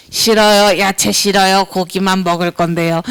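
put-on low, sulky child voice; picky child refusing. Child refusing vegetables from parent. (낮게 뚱한 아이처럼)

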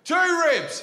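high, loud imitated shout; punchy high yell. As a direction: mimic an audience member yelling an answer. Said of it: "one guy yells out".

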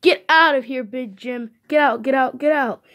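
angry yelling. Acted. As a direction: yell get out at the pest. Angry character throws him out.

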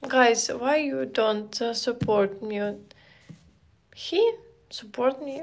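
ordinary talk, nothing marked

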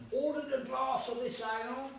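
high, loud, slow melodramatic storytelling; hammed-up waiter tale. Waiter scaring the boy about the ale, melodramatic. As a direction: spin the tale like a sly waiter.

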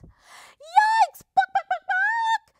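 very high, loud chicken squawks; squawking hen. A narrator's reading. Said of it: "squawked Missus Chicken".

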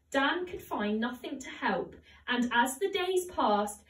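usual speaking voice

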